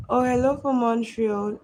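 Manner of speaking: light up at a new viewer; excited greeting. Real, not acted. Greeting a viewer from Montreal, excited and loud.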